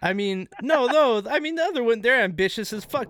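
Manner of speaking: loud, high, heated objection; real worked-up insistence, voice pushed up; emphatic protest. Real, not acted. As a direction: jump in and argue hard for them. Protesting in defence of the women.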